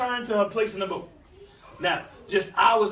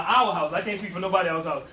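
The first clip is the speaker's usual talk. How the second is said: ordinary talk, nothing marked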